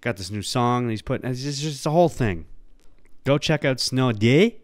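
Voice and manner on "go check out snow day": put-on slow Toronto-accent Snow Day, rising at the end; quoting the Toronto character's catchphrase. Podcaster plugs the song in the Toronto accent. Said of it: "I can do the snow day".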